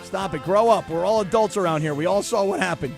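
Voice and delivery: ordinary talk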